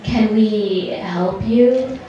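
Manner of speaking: slow, wary question; drawn-out puzzled offer of help; curious. Retold or imitated. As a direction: ask it slowly, as if puzzled by intruders. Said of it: "looked up at us curiously".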